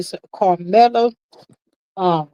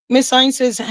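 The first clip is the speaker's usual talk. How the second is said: ordinary delivery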